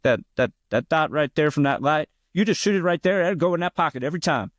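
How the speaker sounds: high, know-it-all bar guy voice; imitating the bar player. Imitating the bar guy giving bad advice, high and sure of himself. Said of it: "he talked like that, of course".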